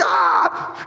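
high mock scream; sudden acted cry of fright; freaked-out shriek. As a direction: imitate a toddler's startled yelp. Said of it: "and then they freak out".

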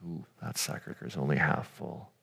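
soft low aside, a quiet oh; hushed realization. Retold or imitated. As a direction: murmur it as a sinking realization. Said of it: "It's like".

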